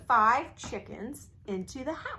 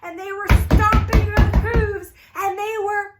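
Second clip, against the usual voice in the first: louder, higher, tumbling telling of the goats' rampage; loud excited telling; 7 semitones higher, 11 dB louder than usual. A narrator's reading. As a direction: build the chaos in the house. Excited goats stomping scene.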